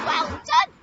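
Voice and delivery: put-on high, loud cheer for a child; excited praise. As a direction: celebrate the child's right answer. Praising children for right answer.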